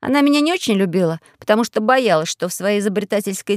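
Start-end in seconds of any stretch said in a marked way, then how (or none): none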